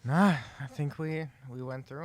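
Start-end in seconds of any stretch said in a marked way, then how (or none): none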